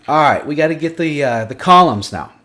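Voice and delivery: booming the next step out at the class; too loud. Real, not acted. Tutor walking viewers through the spreadsheet, booming loudly. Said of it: "Sorry for yelling".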